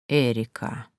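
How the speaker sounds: low, soft, lingering name; drawn-out, savouring. A narrator's reading. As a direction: draw Эрика out low, as if tasting it. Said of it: "протянул он, будто пробуя имя на вкус". (протяжно и низко, пробуя имя на вкус)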